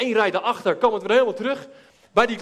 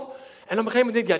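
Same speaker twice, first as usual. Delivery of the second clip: ordinary delivery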